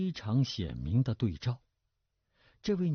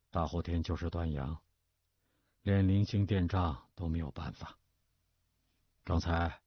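low, subdued fatherly voice; resolved father; 4 semitones lower than usual. A narrator's reading. Father telling his daughter of bond losses, resolved and low. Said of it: "打定了主意似的说".

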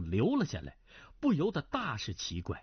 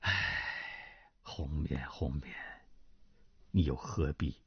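softer, sighing lament; 10 semitones lower, 3 dB quieter than usual. Acted. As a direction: sigh the name out in sorrow. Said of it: "段正淳叹道". (轻声叹息，哀伤)

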